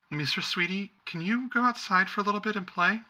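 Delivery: high, sing-song coaxing voice; sweet mother to child. Acted. Mother sweetly sending her young daughter out to play. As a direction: coax the little girl outside sweetly.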